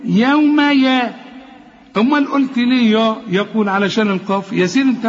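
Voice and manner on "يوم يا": يوم يا slow, both ي equally thin and fronted; light ya. Shown as a demonstration. Teacher proving both letters match, light ي in يوم يا. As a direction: stretch يوم يا, keeping the second ya light. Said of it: "ما هي الياء دي".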